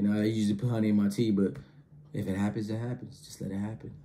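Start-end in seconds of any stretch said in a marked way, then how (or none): 2.1-3.9 softer, sultry fading lines; seductive tease. Retold or imitated. Teasing his date, sultry and fading soft. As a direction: purr it to tempt her. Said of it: "all exotic and provocative".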